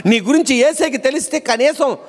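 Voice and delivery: high-pitched scolding devotee; Simeon. Acted. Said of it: "Simeon అన్న భక్తుడు ఏమంటాడంటే".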